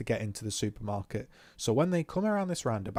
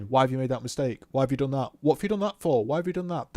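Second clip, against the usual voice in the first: badgering instructor; 5 dB louder, faster than usual. Instructor grilling a learner about a mistake, mock badgering. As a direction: fire the questions off like a pestering instructor. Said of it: "Someone just going".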